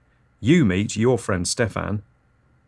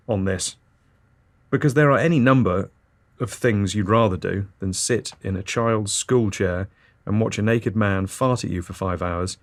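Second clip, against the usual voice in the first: normal talking voice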